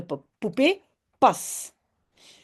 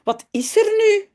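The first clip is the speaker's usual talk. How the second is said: mother asking; 10 semitones higher, 4 dB louder than usual. Acted. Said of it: "Zegt Jips moeder".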